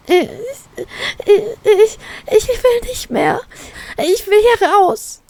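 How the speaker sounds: put-on high, slow, distraught girl's voice; a frightened girl pleading to get out; aufgebrachtes Flüstern. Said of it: "flüstert sie aufgebracht". (hohe, aufgebrachte Mädchenstimme, langsam)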